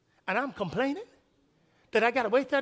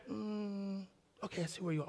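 much softer, lower, checking a list; soft muttered reply; 3 semitones lower, 17 dB quieter than usual. Retold or imitated. Waitress checks him in, soft and low. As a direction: murmur while reading the screen. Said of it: "she says".

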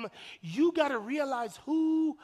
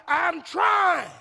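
loud, higher-pitched plea of effort; strained protest; 4 semitones higher, 6 dB louder than usual. Acted. Straining partner tells the other they're trying, loud protest. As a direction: cry it out, straining to be believed. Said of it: "and you be like".

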